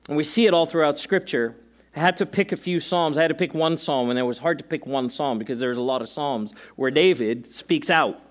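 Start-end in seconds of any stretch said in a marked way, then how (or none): none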